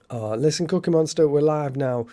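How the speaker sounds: loud cheerful call to a friend. Real, not acted. Calling out to absent friend.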